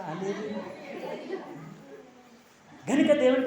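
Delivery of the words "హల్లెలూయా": very quiet, low hallelujah; soft hallelujah. Shown as a demonstration. Preacher showing the congregation a gentle way, soft hallelujah. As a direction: barely voice it, as if not to wake anyone. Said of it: "నెమ్మదిగా చెప్పండి రా".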